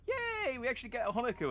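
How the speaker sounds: high, bright little yay; excited cheer. Real, not acted. Cheers at getting an escort.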